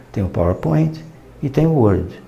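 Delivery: ordinary delivery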